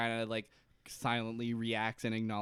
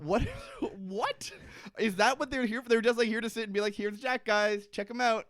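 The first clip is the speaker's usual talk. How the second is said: incredulous high excitement; 9 semitones higher than usual. Retold or imitated. Excited mock zookeeper voice.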